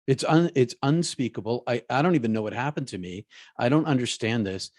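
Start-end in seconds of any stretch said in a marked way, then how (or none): none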